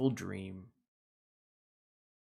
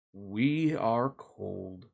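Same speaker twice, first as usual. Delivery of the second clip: shivery cold turtles; 4 semitones lower, much slower than usual. Acted. Turtles voiced in children's story.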